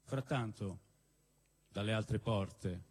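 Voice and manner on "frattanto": ordinary delivery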